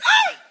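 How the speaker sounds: short high shriek of shock; reenacted scream on swinging the door open; startled scream. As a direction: let out a sudden scared scream. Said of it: "but I said".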